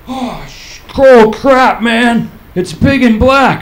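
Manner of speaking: high, loud alarmed yelling, slowing down; panicked shock. Retold or imitated. Bathroom after surgery, loud panicked shock. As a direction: freak out at the sight. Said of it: "and I go".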